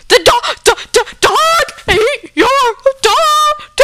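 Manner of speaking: hammed-up hysterical scream, very high and loud; freaking out. Dog catcher phoning the mother with awful news, hysterical screaming. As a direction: stammer in panic, then shriek the last word. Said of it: "starts freaking out too".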